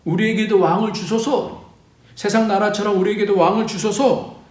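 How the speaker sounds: high-pitched plea for a king; lightly acted collective appeal; demanding plea. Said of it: "왕에 대한 요구가 있었어요".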